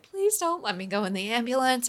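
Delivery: begging. Retold or imitated. Begging her friend to drive her instead, pleading. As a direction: beg not to be put in the ambulance. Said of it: "I begged and begged and begged".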